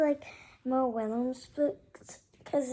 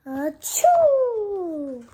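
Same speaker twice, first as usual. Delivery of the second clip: big fake sneeze; 5 semitones higher, 9 dB louder than usual. Gerald sneezes again.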